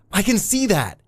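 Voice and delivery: high, impatient shout back; called back. A narrator's reading. Eddie cuts off the constable's introduction, calling back high. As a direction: cut in with a high call. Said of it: "Eddie called back".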